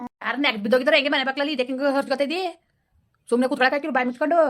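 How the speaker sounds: irritated grandson yelling at grandma; angry scolding. Acted. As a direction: snap at her to shut up and sit down.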